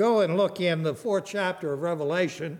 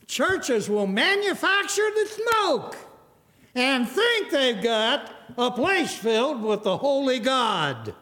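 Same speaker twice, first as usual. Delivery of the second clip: higher-pitched, forceful pulpit delivery; emphatic preaching; 5 semitones higher than usual. Real, not acted. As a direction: drive the rebuke home from the pulpit.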